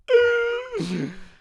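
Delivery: shrill high squeal of laughter. Real, not acted. Host cracks up after insulting his friend, squealing laugh. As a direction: squeal with delight at the dig.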